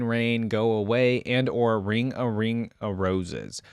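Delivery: normal talking voice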